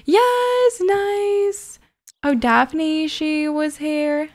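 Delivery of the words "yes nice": high squealed yes; a high little victory cheer; excited cheer. Real, not acted. Player cheering her Sim, high excited.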